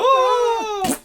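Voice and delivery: high drawn-out mock scream; falling scream. Recounting the villain falling, a long high scream. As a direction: scream as you fall away. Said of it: "you just hear him go".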